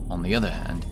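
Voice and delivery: usual speaking voice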